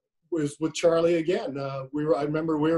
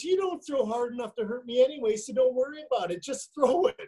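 much higher, amused imitation of an exasperated catcher; dismissive retold scolding; 8 semitones higher than usual. Catcher comes out to tell the pitcher to just throw, mock-exasperated. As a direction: brush the pitcher off in a raised pitch.

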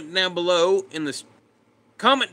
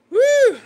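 sudden loud, high-pitched woo; excited whoop; 10 dB louder than usual. Real, not acted. Streamer between replies to viewers, high whoop.

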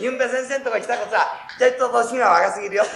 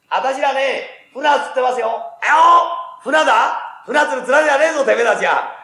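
gruff fishermen snapping at a pest, louder and higher; angry fishermen; 5 semitones higher, 4 dB louder than usual. Acted. Fishermen driving off an odd stranger, angry gruff yelling. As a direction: bark them off like rough anglers.

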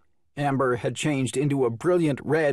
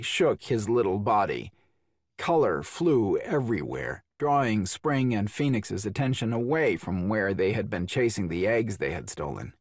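usual speaking voice

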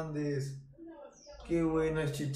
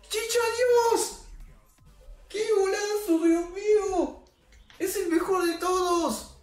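gritos eufóricos muy agudos; celebración de gol; 7 dB louder than usual. Real, not acted. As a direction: celebra el golazo a gritos, riendo. (celebración eufórica a gritos)

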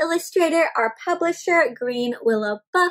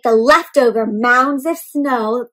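storybook reading; 5 dB louder than usual. A narrator's reading. Teacher reads book aloud.

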